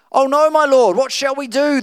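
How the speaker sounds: the servant panics at the surrounding army; panicked servant. Acted. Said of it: "the servant asked".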